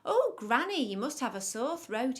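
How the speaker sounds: a hint of a puzzled storybook girl; concerned little girl. A narrator's reading. Girl remarks on Granny's voice.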